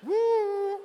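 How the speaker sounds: high loud whoop; excited whoop. Real, not acted. Preacher whoops at his own joke, loud high whoop. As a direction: let out a whoop of delight.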